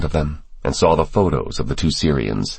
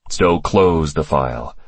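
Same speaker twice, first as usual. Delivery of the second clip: ordinary delivery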